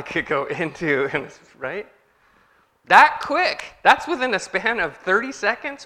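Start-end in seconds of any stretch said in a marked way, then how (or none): 2.9-5.8 high, loud punch on that quick; emphatic exclamation. Real, not acted. Preacher stressing how fast we flip, loud and high. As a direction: hit it hard to wake them up.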